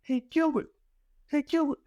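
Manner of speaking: high, hammed-up fake New Zealand accent; bad New Zealand accent. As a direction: prank call as a Kiwi. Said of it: "my horrible New Zealand accent".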